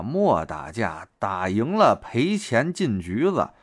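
slow, deliberate admonishing; slow police warning. Retold or imitated. Police warning men against fighting, slow and deliberate. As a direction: spell the warning out slowly like a policeman. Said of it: "警察叔叔那句话说得明白啊".

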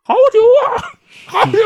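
high, loud cheering cry; acted cry of delight over the liquor; shouting out. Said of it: "老大肚子里边就开始喊".

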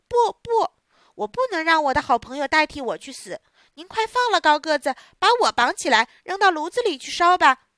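loud, high, resolute cry; brave declaration. Acted. Pinocchio offering himself instead of his friend, loud and resolute. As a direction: stand up and offer yourself boldly.

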